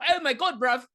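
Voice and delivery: high-pitched British impression; mock British accent. Recapper mimics an actor's slipping accent, mock British. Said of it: "Just be British".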